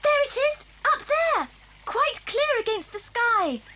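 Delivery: high, loud cry of discovery; excited sighting. Acted. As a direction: call out the sighting to the others. Spots the landmark, shouts to others.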